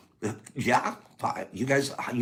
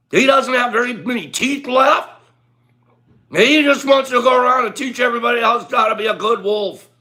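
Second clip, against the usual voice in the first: higher and louder, building to a shout; excited storytelling; 10 semitones higher, 8 dB louder than usual. Real, not acted. Tells wolf story excitedly.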